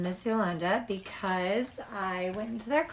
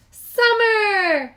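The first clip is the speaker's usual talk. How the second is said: much higher, loud shouted summer; 12 semitones higher, 12 dB louder than usual. A narrator's reading. Cubs answering Papa, shouting eagerly. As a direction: cubs cheering their answer. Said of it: "shouted the Cubs".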